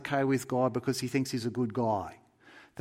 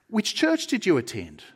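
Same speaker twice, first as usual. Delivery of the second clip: lightly voiced questioner, higher and louder; a hint of an insistent official; supervising angel; 5 semitones higher, 6 dB louder than usual. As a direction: press the newcomer for an answer. Supervisor quizzes the newcomer.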